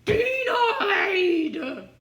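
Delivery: loud, high "Denied!" with a laugh in it; laughing mock shout. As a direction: crow the verdict gleefully. Fifth failure, laughing mock rejection.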